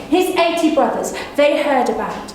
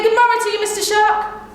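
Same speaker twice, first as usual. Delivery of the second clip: polite rabbit greeting; 6 semitones higher than usual. Acted. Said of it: "I said".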